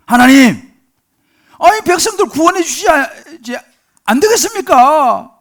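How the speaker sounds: Moses crying out to God, loud and high; 쏟아놓는 원망. Acted. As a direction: pour out an impassioned protest at full voice. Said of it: "하나님 앞에 쏟아놓는 거예요". (하나님께 큰 소리로 부르짖는 항의)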